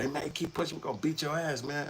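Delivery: high, taunting voice of a stranger; mock threat. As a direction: mimic a crowd guy threatening. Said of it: "So he said".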